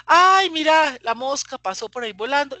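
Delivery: bursts out high and loud, then plays dumb; evasive high exclamation. Retold or imitated. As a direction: distracts the child with a loud, high "ay". Mamá disimula ante el hijo. (exclamación aguda y fuerte, haciéndose la distraída)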